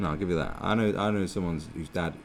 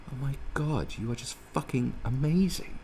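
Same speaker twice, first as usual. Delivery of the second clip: mock smitten; 6 semitones higher, 3 dB quieter than usual. Acting out falling for a girl.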